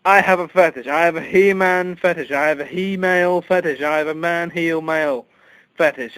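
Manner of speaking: high, loud proclamation; loud confession. Acted. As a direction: declare each fetish like a proud announcement. Loud mock confession list.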